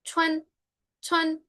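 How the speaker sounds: "Chuan" said high, the ending pulled toward chwen; pron demo. Shown as a demonstration. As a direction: let "chuan" slide into an E-like vowel. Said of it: "detallitos de la pronunciación".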